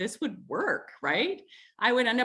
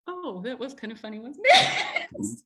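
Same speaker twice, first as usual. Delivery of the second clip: laughing; 5 semitones higher than usual. Retold or imitated. Husband laughing after her tantrum.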